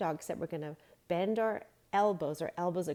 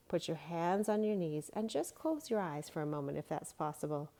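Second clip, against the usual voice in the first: ordinary delivery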